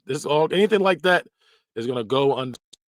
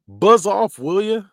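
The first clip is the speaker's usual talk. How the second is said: hammed-up cartoon scold, higher and louder; corny buzz off; 8 semitones higher, 7 dB louder, slower than usual.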